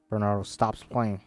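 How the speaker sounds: usual speaking voice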